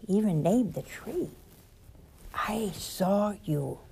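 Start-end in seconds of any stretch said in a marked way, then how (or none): 2.3-3.8 a hushed, drawn-out whisper; slow whisper. Acted. Preacher voicing the Lord to Nathaniel, slow whisper. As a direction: whisper it slowly, like a secret revealed.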